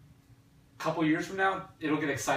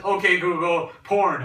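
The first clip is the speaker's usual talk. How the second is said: loud, higher mock command to a phone; 5 semitones higher, 10 dB louder, slower than usual. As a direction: call out the command to the whole room.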